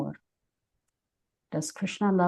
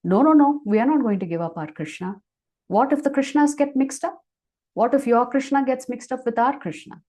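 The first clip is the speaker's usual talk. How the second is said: higher, louder worried protest; perturbed; 5 semitones higher, 5 dB louder than usual. Acted. Gopis refuse to give up their Krishna, perturbed. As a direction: object at once, acting perturbed. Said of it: "The gopis get perturbed now".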